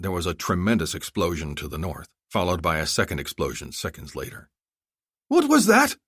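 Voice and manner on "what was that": much higher, louder startled cry; shocked by the blast; alarmed cry. Acted. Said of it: "Zolkadar cried".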